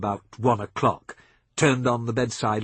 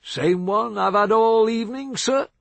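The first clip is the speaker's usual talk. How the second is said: higher, louder drawled American South; lazy southern drawl; 9 semitones higher, 5 dB louder than usual. A narrator's reading. Billy Joe answering Seth in his lazy Southern drawl. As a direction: let the Southern words stretch out easy. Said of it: "in that lazy southern drawl".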